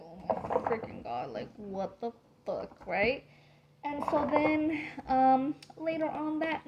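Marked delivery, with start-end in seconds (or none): 0.0-2.9 lower, softer frightened murmur; hushed alone in bed, scared; hushed dread. Retold or imitated. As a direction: breathe it low to yourself in fear. Lying awake, frightened, muttering.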